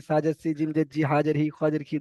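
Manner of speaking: ordinary delivery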